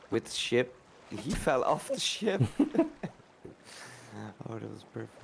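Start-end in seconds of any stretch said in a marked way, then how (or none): 1.1-2.8 laughing through the words; amused, drawn-out telling that cracks into laughter. Real, not acted. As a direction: break into giggles as you finish. Amused retelling of teammate's fall.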